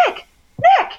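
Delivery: high-pitched retold call. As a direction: mimic calling a friend far away. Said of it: "I would try to call for, to him across campus".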